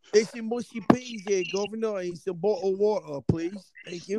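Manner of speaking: exaggerated fake Brit accent; mock British accent. Shown as a demonstration. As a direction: put on a cartoon British accent to order. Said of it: "Yeah, let's hear your accent".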